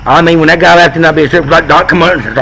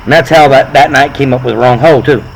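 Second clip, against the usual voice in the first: ordinary delivery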